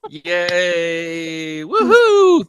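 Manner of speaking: rising whoop of joy; excited cheering. Real, not acted. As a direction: cheer like you just won. Wins the round, cheers.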